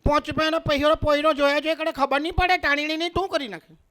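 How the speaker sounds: put-on high, scolding Surti accent; hammed-up Surti dialect, pitched high; Surti woman. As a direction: tell him off like a Surat woman. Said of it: "સુરતની બહેનોને કોક જોઈને".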